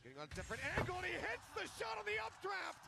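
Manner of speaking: building to a high scream; esports caster hype. Real, not acted. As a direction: rise into the big call.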